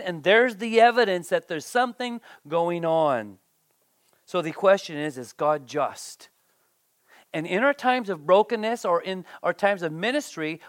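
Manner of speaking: normal talking voice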